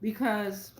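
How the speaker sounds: ordinary talk, nothing marked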